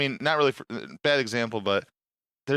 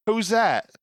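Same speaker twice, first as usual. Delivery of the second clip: higher, louder puzzled voice; lightly voiced baffled streamer; mock clueless; 8 semitones higher, 4 dB louder than usual. Storyteller retelling the streamer's clueless question. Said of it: "you go".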